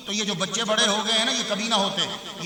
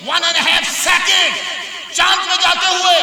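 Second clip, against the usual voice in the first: slow, loud and high, each word hammered; preacher's booming repeat; emphatic shout; 7 semitones higher, 7 dB louder, much slower than usual. Acted. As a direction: shout it out to drive it home. Repeats the number for effect.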